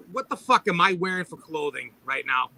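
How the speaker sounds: normal talking voice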